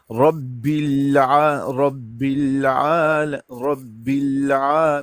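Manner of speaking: رب العالمین, لام held with no sound on release; silent lam. Shown as a demonstration. Teacher drills the sakin lam, silent release. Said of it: "اس وقت آواز نہیں آنی چاہیے".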